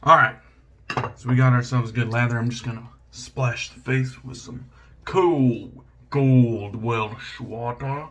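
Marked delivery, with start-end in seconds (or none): 3.1-8.1 slow, stretched-out vowels; Flash Boredom. Retold or imitated. Shaver splashing his face, imitating another shaver, drawn out. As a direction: draw each word out long in imitation. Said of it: "like Flash Boredom says".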